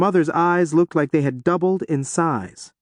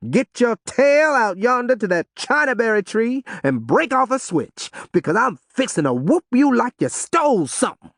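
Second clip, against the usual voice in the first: angry father; 9 semitones higher than usual. A narrator's reading. Father threatens whooping.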